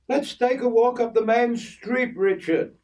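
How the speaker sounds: put-on old Kay chiding the boy about hair oil, high voice; voicing Kay. Kay invites Richard walking.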